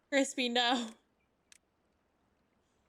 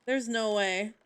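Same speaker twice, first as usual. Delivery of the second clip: ordinary talk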